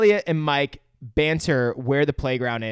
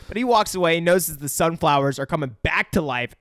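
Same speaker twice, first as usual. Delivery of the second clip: ordinary talk, nothing marked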